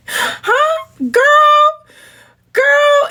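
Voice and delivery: put-on very high squeal of girl; high-pitched incredulous girl; shrieked disbelief. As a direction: squeak it out in shock.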